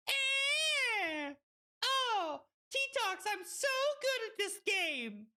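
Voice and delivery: very high whine easing down; high-pitched gamer squeal. Real, not acted. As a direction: squeak out a boast about your play. Squealing over own play.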